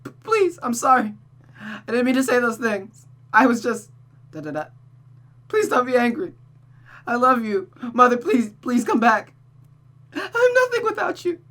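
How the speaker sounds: high, tearful desperate pleading; beg, voice breaking; child pleading for her mother to come back; begging daughter. Acted. Rosa begs Mother to return.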